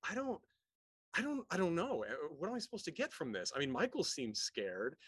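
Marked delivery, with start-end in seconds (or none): 0.1-3.5 high-pitched mock confusion; acted bafflement. Viewer watching the psychedelic scene, mock-baffled. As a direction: play the bewildered viewer, voice jumping up.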